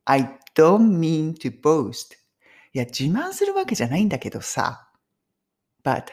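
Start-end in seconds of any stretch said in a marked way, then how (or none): 0.0-2.1 very slow, every word spaced out; very slow model phrase. Shown as a demonstration. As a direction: stretch the phrase out slowly for learners. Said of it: "この言い方".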